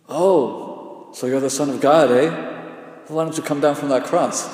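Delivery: mock him from the foot of the cross; scornful laughing taunt; jeering mocker. Mockers at the crucifixion.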